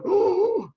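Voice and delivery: pained yelp. Retold or imitated. Pulling splinters, acted pained cry.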